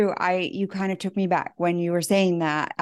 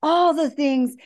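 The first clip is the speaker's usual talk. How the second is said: exploding rant; 10 semitones higher than usual. Retold or imitated. Venting at her family after bottling it up, exploding. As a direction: blow up like a bomb. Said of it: "like a bomb".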